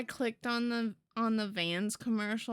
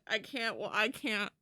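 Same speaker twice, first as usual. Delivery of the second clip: ordinary talk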